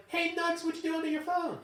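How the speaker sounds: high, sing-song mock of the boss; nosy boss. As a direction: chirp it like a prying supervisor. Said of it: "she's like".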